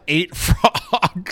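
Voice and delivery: slow, laughing through the words. Real, not acted. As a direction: giggle through it. Laughing while telling story.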